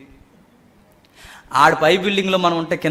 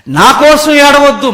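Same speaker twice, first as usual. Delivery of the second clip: emphatic plea; 9 semitones higher, 11 dB louder than usual. Acted. Jesus' words restated.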